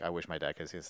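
soft, low tearful murmur; sad quiet. Retold or imitated. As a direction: barely hold back tears. Imitating old man's tearful line.

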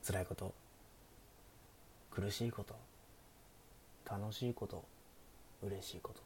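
soft, hushed bedtime greeting; sleepy bedtime stream voice. Pretend. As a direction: lull listeners toward sleep, half-whispered. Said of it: "寝落ち配信的なやってみるか". (眠くなるような寝落ち配信の声)

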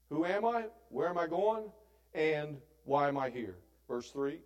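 normal talking voice

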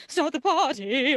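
loud belted singing voice imitation; imitating singer voice. Retelling the movie montage, a loud mock girl's voice. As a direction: mimic one of the girls shouting it. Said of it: "just different voices".

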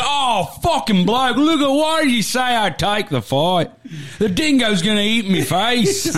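very high, hammed-up Aussie wail; Australian outback accent. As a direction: whine like a cartoon outback Australian. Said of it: "accent of the Australian fucking outback".

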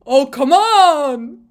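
loud, drawn-out exasperated cry; frustrated radio outburst. Retold or imitated. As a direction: imitate a driver raging over the radio. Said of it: "he's very frustrated".

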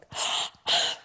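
imitated little girl's gasp of awe; sharp mock gasp of delight; awed gasp. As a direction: gasp like a starstruck child. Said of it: "one little girl was like".

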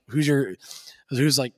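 usual speaking voice

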